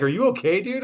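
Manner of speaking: high-pitched, worried coworker; high concerned voice. Retold or imitated. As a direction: ask with mock concern.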